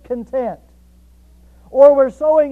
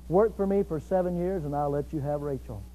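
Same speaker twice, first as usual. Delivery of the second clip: lightly voiced father-in-law, lower and softer; name your terms, low and measured; Laban bargaining; 7 semitones lower, 7 dB quieter than usual. Laban offers Jacob a deal for Rachel, low and measured.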